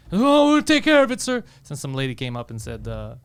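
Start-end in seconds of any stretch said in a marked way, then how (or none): 0.1-1.4 high, loud, overly polite clerk voice; mocking front desk clerk. Front desk clerk placating an angry guest, high mocking voice. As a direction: ham up the eager hotel clerk.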